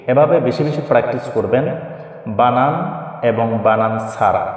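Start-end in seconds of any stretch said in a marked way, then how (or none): none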